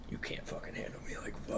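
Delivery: breathy mock-villain whisper that breaks into a laugh; menacing whisper. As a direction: taunt them in a whisper, then crack up. Villain taunting the beaten heroes.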